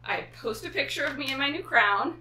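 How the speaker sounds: normal talking voice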